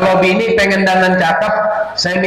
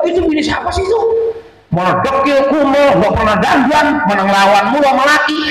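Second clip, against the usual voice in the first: sneering gossip; 10 semitones higher than usual. Retold or imitated. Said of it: "orang ngomong".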